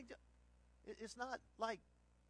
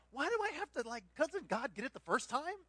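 put-on boyish complaint; 7 semitones higher, 3 dB louder than usual. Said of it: "I remember thinking as a boy".

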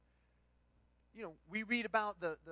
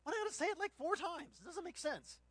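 put-on boyish complaint; 7 semitones higher, 3 dB louder than usual. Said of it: "I remember thinking as a boy".

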